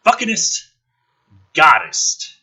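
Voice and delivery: usual speaking voice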